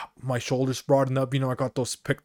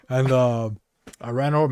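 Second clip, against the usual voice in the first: usual speaking voice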